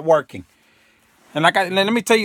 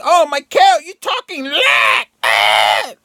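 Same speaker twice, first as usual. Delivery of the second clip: shrill high shouting outrage at Michael, much higher and louder; mocking scolder; 8 semitones higher, 4 dB louder, slower than usual. Viewers scolding Michael for talking negative, high mocking outrage. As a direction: ham up a scandalized viewer scolding him. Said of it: "I know right now you're saying".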